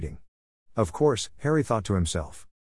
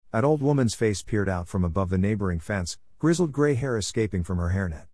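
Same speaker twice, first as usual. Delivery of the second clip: ordinary delivery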